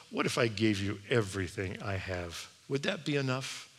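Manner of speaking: soft, hushed, tentative question; humble offer. Acted. A little boy offering Jesus all he has, softly.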